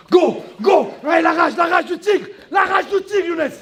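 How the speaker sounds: high rallying cry; fired-up shout. Real, not acted. Coach calling for the rage of the tiger, shouting. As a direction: whip him up for one last burst. Coach firing up fighter for last effort.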